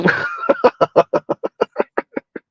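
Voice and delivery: breathless squeaky laugh; high wheezing laugh. Real, not acted. Laughing at the fantasy.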